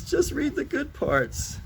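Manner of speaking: put-on high, drawn-out little-kid voice; slow, high-pitched plea from a child. Tired kid to Papa reading a story, high child voice. Kid pleading in comic strip.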